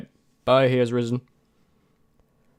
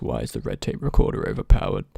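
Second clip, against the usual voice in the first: much lower, dragged-out mock quote of the question; mock deep voice; 13 semitones lower, 3 dB louder than usual. Mocking the viewer who asked, much lower mock voice. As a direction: drop way down and mock the asker. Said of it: "You come here, you ask".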